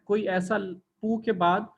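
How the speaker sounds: ordinary delivery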